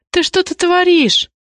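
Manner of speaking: high, drawn-out indignant question. A narrator's reading. Zoya protesting as she is carried out, indignant. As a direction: voice shoots up in outraged protest. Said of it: "возмутилась Зоя".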